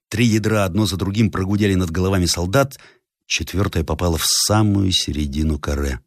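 ordinary delivery

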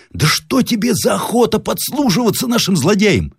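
high, indignant disbelief; incredulous outburst, voice way up; astonished cry. A narrator's reading. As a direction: question him in high-pitched amazement. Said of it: "вскричал с удивлением мастеровой".

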